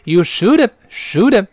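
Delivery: "should've" run together as "shoulda-v"; linked reading. Shown as a demonstration. Teacher correcting the full form, "should've" linked. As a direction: say "should've" as one word, no h sound. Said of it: "不要读should have啊".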